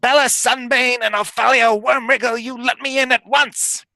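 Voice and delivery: high, scratchy hag demand. Acted. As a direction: shriek it like an old hag. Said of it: "a scratchy voice say".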